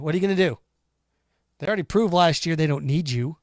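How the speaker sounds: ordinary talk, nothing marked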